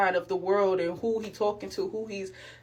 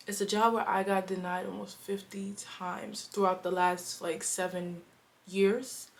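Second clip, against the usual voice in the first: whispering; 5 dB quieter than usual. Real, not acted. Confiding the job history.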